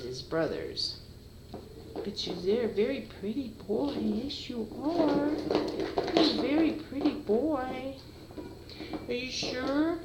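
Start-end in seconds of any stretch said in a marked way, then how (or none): 1.9-10.0 cooing to a kitten. Real, not acted. Owner cooing to her pretty kitten in baby talk.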